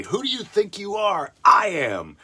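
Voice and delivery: hammed-up retort; mock shout. Retelling the bowler's line to the heckler, mock shout. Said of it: "such an awesome accidental thing to say".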